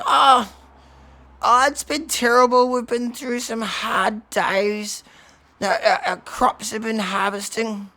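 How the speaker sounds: high, loud, slow lamenting elder; Bob. Acted. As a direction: wail the hard times in long, drawn-out words. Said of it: "leader Bob, he says".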